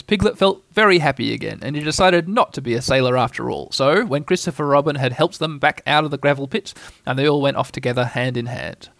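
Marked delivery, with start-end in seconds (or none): none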